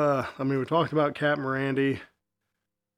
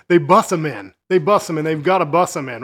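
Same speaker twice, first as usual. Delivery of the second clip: insistent retort; 3 semitones higher, 8 dB louder than usual. Retold or imitated. Friend arguing back about who will come, insistent.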